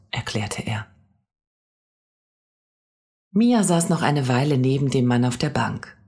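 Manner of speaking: plain reading voice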